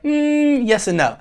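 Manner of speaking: high hesitant hum, then answer; hemming and hawing. Retold or imitated. Imagined reply to a viewer's question, noncommittal hedging. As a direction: waver noncommittally. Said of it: "And I'll be like".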